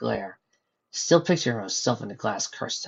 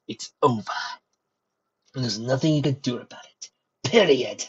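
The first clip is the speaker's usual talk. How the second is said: snarling. Acted. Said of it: "Curling his lips in a snarl".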